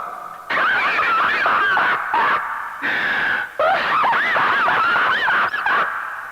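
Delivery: high, breathy, drawn-out laugh; laughing at his own jab on the radio; long howling laugh. Real, not acted. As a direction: let a long laugh roll on. Long laugh after mocking Greg.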